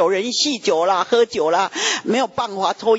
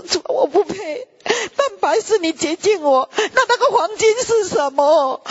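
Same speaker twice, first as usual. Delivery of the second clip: choked-up prayer; 9 semitones higher than usual. Retold or imitated. Moved prayer to God.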